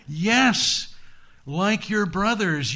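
high, loud, emphatic yes; triumphant recognition. Acted. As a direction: pronounce it like a verdict on them. Buddha pronounces on the daughters.

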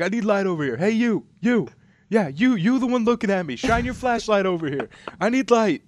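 high, loud Boston cop; Boston accent. Retold or imitated. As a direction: bark orders in Boston accent. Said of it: "thick Boston accent".

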